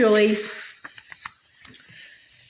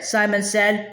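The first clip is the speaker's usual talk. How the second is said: ordinary delivery, nothing marked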